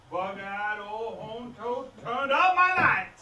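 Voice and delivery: slow seethe rising to a loud burst; building outrage. Acted. Man learning his lights are off, outrage exploding. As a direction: start measured, erupt at the end.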